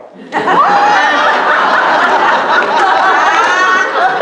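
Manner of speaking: real laugh breaking out; helpless loud laughter; big high laugh. Real, not acted. Congregation story punchline.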